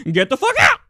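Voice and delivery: high, loud put-on tough-guy shout; hammed-up macho shout, laughing at himself; mock macho yell. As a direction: redo the cracked yell as a would-be macho man. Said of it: "Hard to sound like a macho man".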